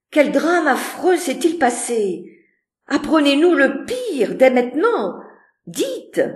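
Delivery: high, loud, urgent questioning; anxious demand. A narrator's reading. Miss Ingram begging Rochester for news, frightened and urgent. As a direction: press him to tell the worst.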